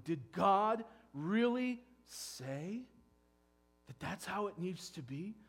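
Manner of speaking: very slow, rising mock-doubting tempter; evil one's sly question. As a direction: stretch it out to sow doubt. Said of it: "here comes the evil one".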